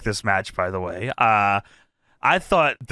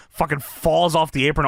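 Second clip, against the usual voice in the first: normal talking voice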